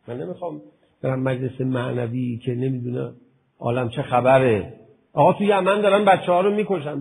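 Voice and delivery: loud, fervent sermon voice; preaching at full force. Acted. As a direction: preach it hard to the hall. (با شور و صدای بلند موعظه کن)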